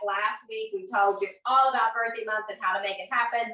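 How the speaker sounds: usual speaking voice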